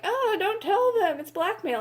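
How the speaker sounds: high, mock-pleading squeak of a scared character; mock high voice sending up a hated trope; mocking whiny character. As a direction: parody a timid heroine in a squeaky voice. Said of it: "that one fucking trope that I hate of just like".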